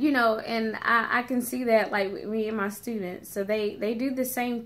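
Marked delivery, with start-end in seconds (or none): none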